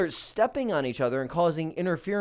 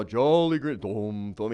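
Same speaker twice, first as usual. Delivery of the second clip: giant footsteps; 7 semitones lower, slower than usual. Retold or imitated. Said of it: "this big giant guy on the commercial".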